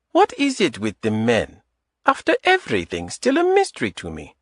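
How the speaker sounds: put-on Adita teasing him in a light Eastern European accent. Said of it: "In a light Eastern European accent".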